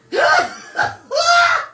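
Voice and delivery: high, shrieking burst of laughter; loud shrieking laugh. Real, not acted. Cohost loses it laughing.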